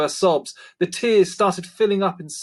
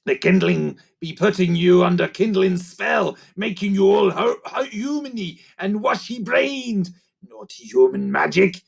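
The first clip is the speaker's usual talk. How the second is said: accusing creaker; 5 semitones higher than usual. A narrator's reading. Grunt accusing Lucy.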